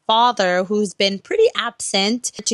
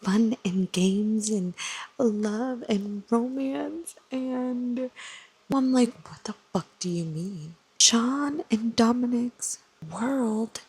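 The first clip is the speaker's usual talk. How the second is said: hushed reactions. Real, not acted. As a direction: keep it hushed so nobody wakes. Late-night hushed reading reactions.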